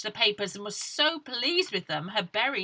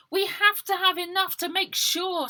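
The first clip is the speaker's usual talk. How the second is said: put-on higher, louder insistent girl; high, determined girl voice; 12 semitones higher, 6 dB louder than usual. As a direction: refuse to give up. Ida insisting.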